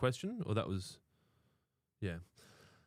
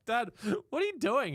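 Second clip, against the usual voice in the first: alarmed daughter; 7 dB louder than usual. Retold or imitated. Daughter voiced in retold video.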